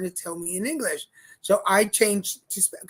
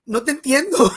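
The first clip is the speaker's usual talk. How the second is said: louder, higher baffled reply in Spanish; exasperated Spanish; 4 semitones higher, 8 dB louder than usual. Retold or imitated. Tourist at hotel reception can't understand the clerk, exasperated. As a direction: tell the clerk you don't understand him. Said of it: "I'm like".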